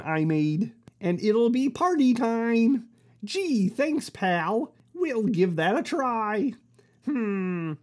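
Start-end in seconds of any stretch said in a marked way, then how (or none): none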